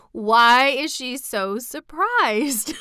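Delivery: slow, high, laughing question; amused laughing. Real, not acted. Reader comments on Dorothy's surprise, laughing. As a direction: draw it out loudly amid laughter.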